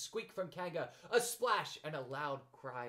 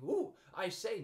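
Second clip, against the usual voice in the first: excited; 6 semitones higher, 5 dB louder, slower than usual. A narrator's reading.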